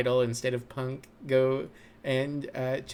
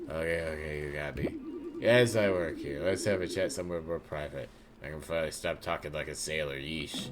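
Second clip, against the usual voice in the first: lower, quieter relieved confession; Pigeon Pete voice; 6 semitones lower, 4 dB quieter than usual. Acted. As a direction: give in and drop the act. Pigeon Pete drops sailor act.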